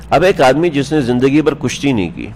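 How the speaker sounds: usual speaking voice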